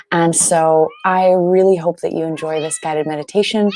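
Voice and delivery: ordinary talk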